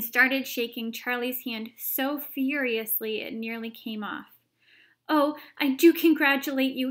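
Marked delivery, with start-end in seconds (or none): 5.1-6.9 loud, bright congratulations; warmly exclaimed congratulations, raised voice; crying out with joy. A narrator's reading. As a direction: gush with delight. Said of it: "he cried".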